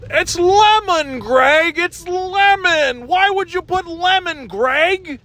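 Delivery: very high, slow, drawn-out scolding; Tom Wambsgans impression. Podcaster mimicking Tom scolding Cousin Greg, high and slow. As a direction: stretch out lemon in a high whiny voice. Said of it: "my first attempt at a Tom Wambsgans".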